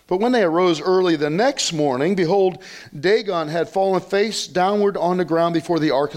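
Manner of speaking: high, emphatic Bible-reading voice; dramatic scripture reading. Acted. Preacher reading Dagon's second fall to the congregation, high and emphatic. Reads scripture climax aloud.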